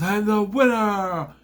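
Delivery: slow, high, loud bragging; mock gloating shout. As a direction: gloat to the whole arena. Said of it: "he goes".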